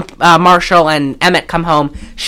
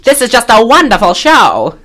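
put-on theatrical Mid-Atlantic accent, pitched up; gushing old Hollywood Mid-Atlantic voice; Ann Miller; 4 semitones higher than usual. Movie star praising a show, Mid-Atlantic accent. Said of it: "Ann Miller talked like that".

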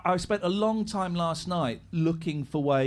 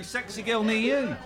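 ordinary delivery

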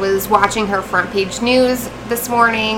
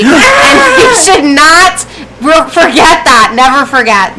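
louder, urgent rally cry; 4 semitones higher, 12 dB louder than usual. Real, not acted. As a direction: proclaim it like a protest chant. Rallying call.

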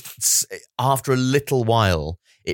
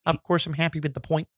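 a light, softer take on a bland driver interview; mock Lance Stroll; 7 semitones higher, 5 dB quieter than usual. Quoting the driver's post-race interview in a lightly put-on voice. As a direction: toss it off like a dutiful driver quote. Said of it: "He said so in an interview afterwards".

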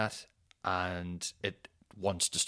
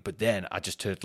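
usual speaking voice

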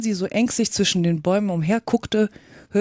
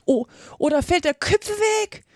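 high, urgent warning cry; warning call; 10 semitones higher than usual. A narrator's reading. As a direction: call a warning, pitch rising sharply. Warning as slates fall. (hoher Warnruf)

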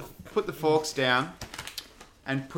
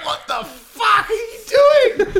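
incredulous shout; 11 dB louder, slower than usual. Retold or imitated. Retold mate yelling at crash.

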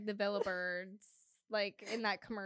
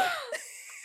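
gasp. Real, not acted. Reacting to Casey's height reveal.

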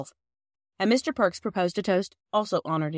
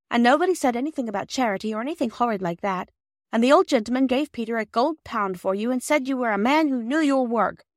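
high, louder, pleading rush; earnest girl in haste; 6 semitones higher, 4 dB louder than usual. Acted. Girl pleading with offended Perks, high and hurried. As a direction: pour it out earnestly, pitched up.

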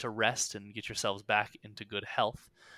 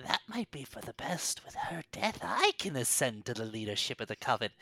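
scheming whisper; 4 dB quieter than usual. Acted. Hag plotting aloud.

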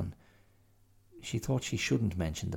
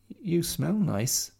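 mild tearful; 10 semitones higher, 4 dB louder, slower than usual. Acted. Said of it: "said Mia as she cried".

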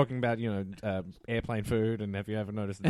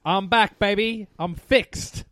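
high, loud triumphant return; excited; 9 semitones higher, 7 dB louder, much slower than usual. Real, not acted. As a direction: announce your comeback with glee. Returning guest greets listeners.

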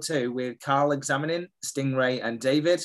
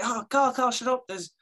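much higher, gushing voice of the wife; a wife bursting with joy; excited mock wife; 10 semitones higher than usual. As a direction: squeal it like she can't contain the news. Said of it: "Susan's like".

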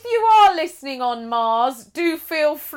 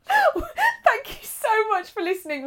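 laughing; 11 semitones higher than usual. Real, not acted. Sign-off after a laugh.

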